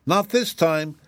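high, decided refusal; flatly refuse, rabbit voice; firm rabbit. A narrator's reading. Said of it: "said the rabbit decidedly".

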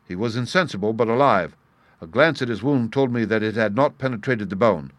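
usual speaking voice

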